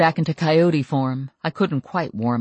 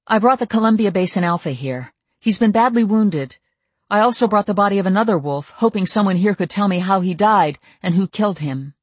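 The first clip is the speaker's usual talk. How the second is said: higher, louder, urgent report; 6 semitones higher, 4 dB louder than usual. A narrator's reading. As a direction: push it out, anxious and quick. Mercy pleading to Bran urgently.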